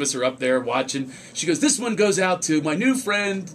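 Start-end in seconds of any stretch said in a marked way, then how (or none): 1.6-3.5 stage announcement. Retold or imitated. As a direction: announce it grandly to the crowd.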